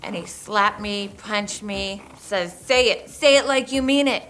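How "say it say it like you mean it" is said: loud, higher retold demand; a forceful retold shout of the attacker's order; angry retold yell.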